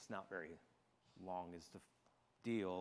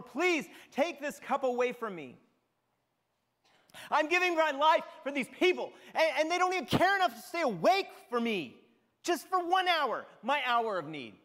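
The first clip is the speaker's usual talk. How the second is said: loud, high cry to God; anguished plea; 6 semitones higher, 3 dB louder than usual. Acted. Jesus at his third prayer pleading aloud, anguished loud cry.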